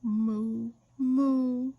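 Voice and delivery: slow, drowsy cow lowing; a sleepy cow's drawn-out moo. A narrator's reading. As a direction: moo like a cow half asleep. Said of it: "answered in a very sleepy voice".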